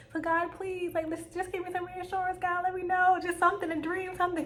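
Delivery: high, earnest plea to God; pleading prayer. Retold or imitated. Praying to God for a sign.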